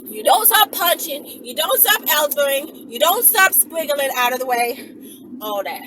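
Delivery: usual speaking voice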